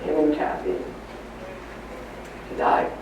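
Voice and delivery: hushed, broken retelling of bad news; barely voiced, as the mother breaking the news through tears; tearful hush. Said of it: "She starts crying".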